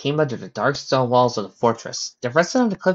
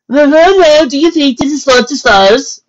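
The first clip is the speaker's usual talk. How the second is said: loud, high-pitched horse soldier; 13 dB louder than usual. Acted. Soldier horse asking his fellow on the road, loud character voice.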